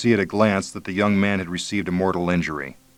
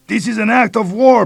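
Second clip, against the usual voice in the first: put-on high, loud bellow with Mexican accent; shouting; 7 dB louder than usual. A narrator's reading. Police chief bellowing at American invaders, loud shout. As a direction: bellow it from the jungle. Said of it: "Barreo shouted back".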